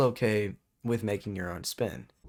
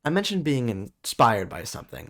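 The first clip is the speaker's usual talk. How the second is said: usual speaking voice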